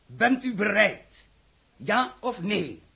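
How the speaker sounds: slow demand. Acted. Demanding an answer from the council.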